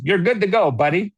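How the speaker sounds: hearty high "You're good to go, buddy"; folksy American catchphrase. Retold or imitated. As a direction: toss it off like a friendly American. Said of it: "That's the expression that's very American".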